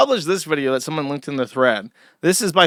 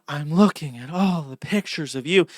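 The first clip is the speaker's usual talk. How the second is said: imitating a breathy ASMR voice; mock breathy roleplay voice. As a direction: mimic her airy, intimate ASMR delivery. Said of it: "that's very breathy".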